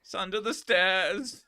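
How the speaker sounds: a silly German character line; bad German accent. Streamer answering his own German skit, hammed-up German accent. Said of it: "His German accent is terrible".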